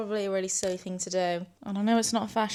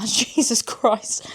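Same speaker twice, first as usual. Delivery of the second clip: startled laugh; 2 semitones higher, 6 dB louder, much slower than usual. Real, not acted. Neighbour gave jump scare.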